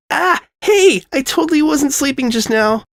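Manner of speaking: put-on high, startled girl voice; startled sleepy girl. Sonata waking under Sudsy, high startled denial. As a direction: jolt awake and deny it.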